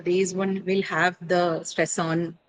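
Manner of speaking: ordinary talk, nothing marked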